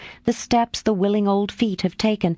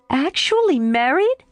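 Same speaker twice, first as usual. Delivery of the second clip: high, disbelieving question; incredulous; 8 semitones higher than usual. A narrator's reading. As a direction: still can't take it in.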